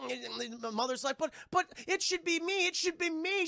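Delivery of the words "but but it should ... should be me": high-pitched imitation of a pleading mom; pleading mother.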